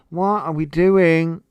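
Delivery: slow, loud, fed-up what are we doing; exasperated loud cry. Real, not acted. Fed up with renamed haircuts, she cries out slowly. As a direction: shout it in disbelief, drawn out. Exasperated at new hair names.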